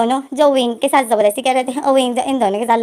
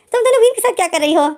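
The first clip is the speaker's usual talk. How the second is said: higher, fast angry demand; rushed, raised scolding; 7 semitones higher, faster than usual. A narrator's reading. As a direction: confront them fast and sharp. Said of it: "याली गुस्सा हो जाती है".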